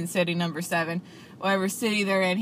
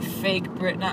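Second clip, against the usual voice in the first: normal talking voice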